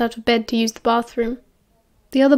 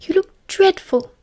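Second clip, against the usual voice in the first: high, slow, worried voice; dismayed at how ill a friend looks; drawn-out, high-pitched fretting; anxious concern; 8 semitones higher than usual. A narrator's reading. Anxious friend sees him unwell.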